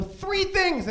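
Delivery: put-on high, loud kid voice; squeaky demand. Kid bargaining with his big sister, high and pushy. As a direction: pipe it up like a bratty kid. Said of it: "I go".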